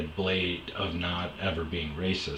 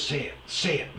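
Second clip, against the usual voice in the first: higher, mocking "Say it, say it" in a put-on voice; taunting ex; 9 semitones higher than usual. Ex goading him mid-fight, higher mocking taunt. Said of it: "she goes".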